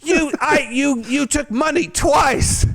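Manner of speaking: put-on high, slow sputtering drunk shout; drunken shout. Drunk guy shouting at a stripper who took money twice, high sputtering shout. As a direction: splutter it out, outraged and drunk. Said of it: "everyone's laughing at me 'cause I'm going".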